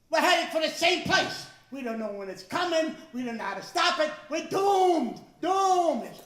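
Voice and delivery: loud, high mock-dire proclamation; doomsday announcer. Science Channel narrator warning everyone of doom, hammed-up shout. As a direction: boom it out like a panicked TV scientist. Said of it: "Guess what they tell us?".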